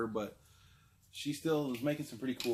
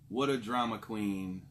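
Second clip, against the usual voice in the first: ordinary talk, nothing marked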